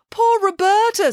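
high-pitched dramatic lament; theatrical grief for a friend. A narrator's reading. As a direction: wail it with pity. Nanny laments her dear friend.

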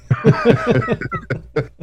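high squealing laugh; burst of laughter. Real, not acted. Loud laugh after joke.

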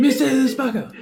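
high, laughing imitation of the clip; squeaky mock echo. Cohost echoing the clip, high and laughing. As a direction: parrot the line in a squeaky giggle. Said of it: "echoing the clip".

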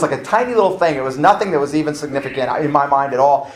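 normal talking voice

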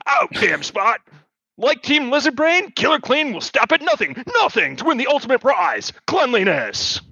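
high, punchy infomercial pitch; ad announcer. Acted. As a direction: sell it like a cheesy ad. Spoof commercial read.

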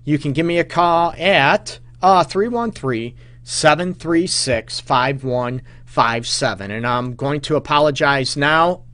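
normal talking voice

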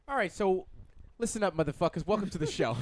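mock show host. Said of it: "No, I'm just kidding".

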